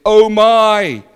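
high, loud anguished cry; dying man's terror. Retold or imitated. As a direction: cry out in horror at the end. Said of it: "he said".

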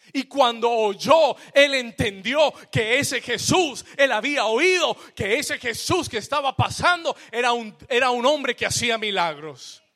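loud, rising preacher's cry; full-force preaching. Acted. Preacher to his congregation, at full force. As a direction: preach it out to the whole church.

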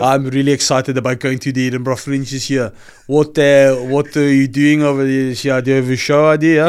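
put-on Brummie interviewer; Birmingham accent. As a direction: chat eagerly in a mock Birmingham accent. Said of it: "That was just my Birmingham".

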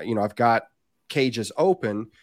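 ordinary talk, nothing marked

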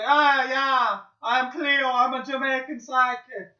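put-on loud, high Jamaican accent; Cleo Jamaican accent. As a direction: ham up a Jamaican psychic accent. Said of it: "I could do a Jamaican accent".

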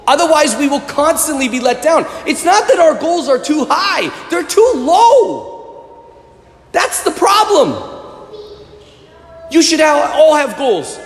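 high-pitched, building sermon climax; preacher crying out, pitch climbing; impassioned preaching. Real, not acted. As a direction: drive the point home to the congregation. Preaches at raised force.

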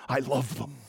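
soft and close, voice nearly breaking into a whisper; tender whisper. Acted. The Son telling his Father these people are his, in a tearful whisper. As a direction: let it fall to a tearful hush.

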